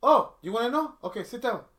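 lightly voiced wife, knowing tone; accountant's voice. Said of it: "She goes".

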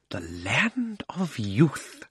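slow and hushed, savoring each word; softly, as if seeing paradise; dreamy wonder. A narrator's reading. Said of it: "in a happy dream".

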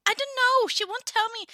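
agitated, pitch jumping up; flustered. Acted. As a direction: blurt it out helplessly, high-pitched. Answering in character, flustered.

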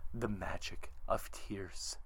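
slow, near-whispered reveal; hushed mystique. Retold or imitated. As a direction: drop low and savour it slowly, very softly. Ends retelling with collector's mystic phrase.

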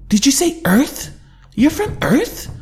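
can hardly believe his ears; stunned disbelief. A narrator's reading. King repeats the news in amazed disbelief.

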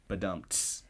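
low, soft drum-and-cymbal imitation; vocal rimshot. Shown as a demonstration. Narrator marks the sushi pun, softly voiced rimshot. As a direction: voice a quiet punchline drum hit.

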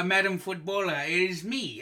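ordinary talk

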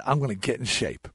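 quiet earnest vow under the breath; hushed vow. Retold or imitated. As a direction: say it like a secret oath to God. Hushed solemn vow to God.

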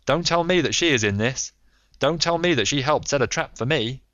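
a hint of a trapped, bitter man; caught American rogue. A narrator's reading. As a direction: play him cornered but defiant. Arrested American's sardonic speech.

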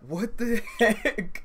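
high, slow, stunned laugh through it; shocked disbelief. Retold or imitated. Fan telling a friend how he and his dad reacted, in high laughing disbelief. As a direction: gasp it out in disbelief.

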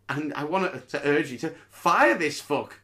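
normal talking voice

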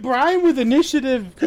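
laughing through the words; teasing giggle. Real, not acted.

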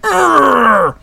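high, drawn-out "arr" growl; imitated posing roar. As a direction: mimic a wrestler roaring through a pose. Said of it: "He just does this pose. He's like".

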